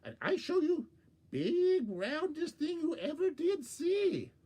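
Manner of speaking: high, sly little trickster voice; Borgita the trickster. Acted. Borgita coaxing Coyote to the pond, high and sly. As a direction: lure him in with a sweet, high voice.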